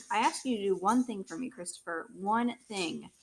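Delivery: loud, hammering on one thing; angry scolding. Acted. Father reminds Christopher of his one request, loud and angry. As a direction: press each word in anger.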